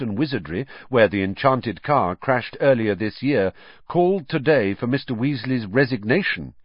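announcer reading the day's story; newspaper report read aloud. Acted. Reads out a newspaper cutting.

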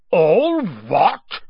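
loud, outraged roar, rising high; booming, drawn-out outrage; thundering. A narrator's reading. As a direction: thunder the question back in disbelief. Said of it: "Hagrid thundered".

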